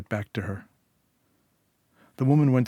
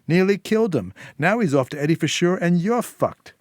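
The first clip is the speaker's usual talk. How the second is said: higher, louder furious scolding, rushing near the end; angry rant; 5 semitones higher, 5 dB louder than usual. Acted. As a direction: lay into him, building fury. Sil tears into Declan.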